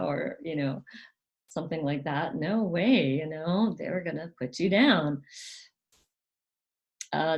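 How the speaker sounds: ordinary delivery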